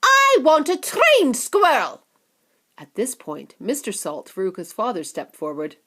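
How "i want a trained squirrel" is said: high, slow loud demand; spoilt girl. Acted. Veruca insisting on a trained squirrel, high loud slow demand. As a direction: stress trained, stubborn.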